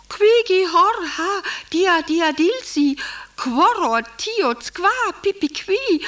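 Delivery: high, swooping bird-call syllables; answering bird code. Acted. Wife answering in nightingale code into the receiver, high chirping. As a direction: chirp nonsense syllables in leaping calls. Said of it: "ich antworte in den Hörer".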